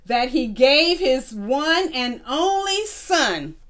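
put-on slow, word by word, for children to repeat; slow dictation. Shown as a demonstration. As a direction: spell each word out slowly so the class can follow. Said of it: "I'm gonna read it slow".

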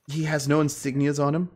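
usual speaking voice

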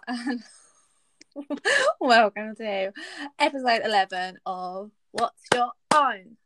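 ordinary talk

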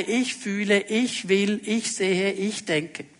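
normal talking voice

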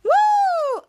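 high, loud "woo"; long high-pitched cheer; celebratory whoop. Real, not acted. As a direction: whoop with relief at finishing. Whoops after finishing recap.

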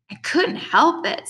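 kind of whining. A narrator's reading. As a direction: whine it in self-defence, drawn out. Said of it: "kind of whining".

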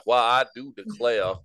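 slow, hammed-up Southern plantation drawl; mock Southern belle accent. Friend mocking Southern talk over chicken, in a Southern belle accent. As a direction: put on a genteel old Southern accent, slowly. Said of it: "that old plantation Southern accent".

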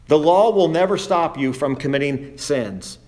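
ordinary talk, nothing marked

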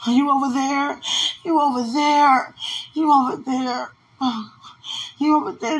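high, loud repeated call across the room; anxious calling out. Real, not acted. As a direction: call out to someone unseen, scared. Calling out in distress.

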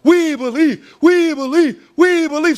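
high loud chanting; excited crowd. Acted. Crowd chanting up to the walker: high loud chant.

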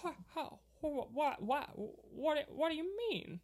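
high, stammering impression; halting, repeated starts before the question comes out; Jimmy Stewart. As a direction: stumble over each word in a high voice. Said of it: "You do a great Jimmy Stewart".

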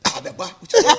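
hushed mock sleep-talk; Arthur talking asleep. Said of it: "in his sleep and do like this".

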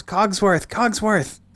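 high-pitched, insistent name-calling; Chip calling out. Acted. Chip calls again to the shut-eyed clock, high-pitched call. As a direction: call up high to a hiding friend.

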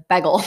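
bagel said BEG-ul; short "eh" in bagel; imitated mispronunciation. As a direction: say bagel with its first syllable like "beg". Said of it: "Like he says bagel".

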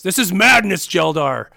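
alarmed orc warrior. Acted. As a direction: plead against the madness. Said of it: "Brachus immediately says".

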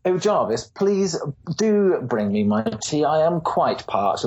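high, slow and lordly; imperious plummy grande dame. Retold or imitated. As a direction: call for tea like a pompous lord. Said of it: "So I might be like".

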